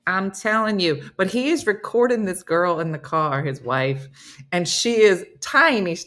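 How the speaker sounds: ordinary talk, nothing marked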